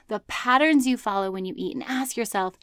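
ordinary talk, nothing marked

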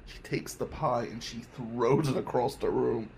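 normal talking voice

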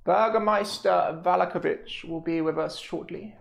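formal lady voice. Acted.

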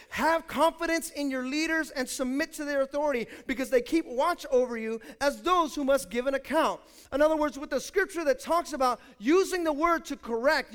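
loud, high preaching voice, building; preaching at full force. Acted. Pastor preaching to his church, loud and driving. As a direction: preach it hard to the congregation.

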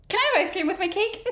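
put-on high, quick, sweet child voice; little girl. Lilith asking her parents for a treat, sweet childlike voice. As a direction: ask it brightly like nothing happened. Voicing Lilith after the killing.

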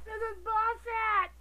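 angry outburst. Real, not acted. Ranting alone about remakes.

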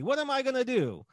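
high-pitched theatrical lament; mock anguish. Don Shirley pleads after leaving the car, mock anguish. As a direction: wail it like an acting-school monologue.

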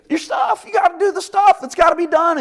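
preacher hitting the peak; emphatic preaching shout. Real, not acted. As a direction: shout the point to the room. Preacher builds to emphatic point.

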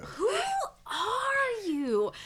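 high, strained, crying "are you"; Vine meme voice. Retold or imitated. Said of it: "That's my older brother if he was Vine".

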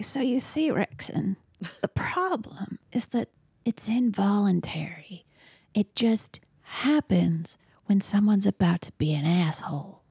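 hushed, half-whispered in-character threat; whispery mock-villain speech with deliberate pauses; mock menace. Player continues menacing speech to bully.

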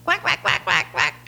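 mock quacking. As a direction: quack at the ducks to lure them, half-joking. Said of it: "the food, and I go".

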